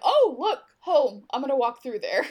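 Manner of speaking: a touch of carefree mock discovery; lightly voiced oblivious character. Retelling Cecily's blithe walk through the portal, lightly voiced.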